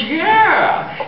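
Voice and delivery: very high, delighted Irish cry; hammed-up high Irish brogue; drunk Irishman. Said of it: "the Murphy twins are drunk again".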